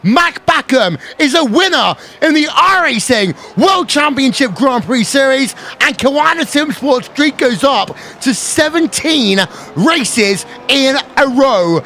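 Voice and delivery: excited race commentary, pitch climbing for the win; live play-by-play. Acted. Race announcer to viewers on the final lap, excited play-by-play.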